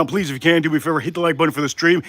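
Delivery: normal talking voice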